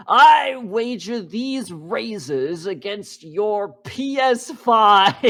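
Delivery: slow, high, loud theatrical villain voice; mock Sweeney Todd wager. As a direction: ham up the grand wager like a stage barber.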